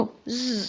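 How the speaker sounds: soft buzzing hum; bee buzz. Shown as a demonstration. Teacher showing the class the bee's buzz. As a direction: buzz like a honeybee. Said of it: "zzz എന്നുള്ള ശബ്ദം".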